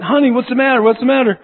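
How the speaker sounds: high, loud urgent concern; worried dad bursting into the bedroom; anxious father. Retold or imitated. Father calming screaming child at night.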